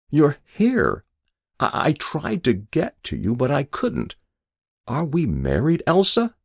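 high, halting, dazed questioning; confused waking patient. A narrator's reading. Patient waking to his wife, high confused voice. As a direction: stumble out of a dream in a high voice.

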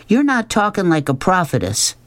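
put-on high-pitched girl's accusation; accusing. A narrator's reading. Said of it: "she accused".

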